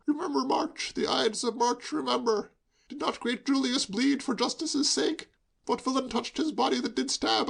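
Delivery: high, theatrical Shakespearean ranting; tipsy young man declaiming. A narrator's reading. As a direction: play Brutus with tipsy relish. Hugo declaiming Shakespeare drunkenly.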